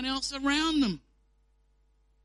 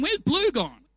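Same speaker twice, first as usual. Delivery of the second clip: baffled; 5 semitones higher, 6 dB louder than usual. Retold or imitated. Puzzled listeners in prayer group.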